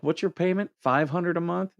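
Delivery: a light mocking lilt; mocking question. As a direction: needle him about what he pays. Mocking the buddy's car payment.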